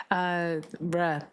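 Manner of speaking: ordinary talk, nothing marked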